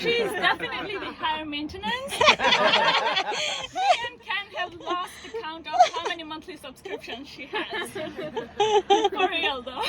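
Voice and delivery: laughing. Real, not acted. Laughing about subscriptions.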